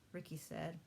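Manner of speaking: usual speaking voice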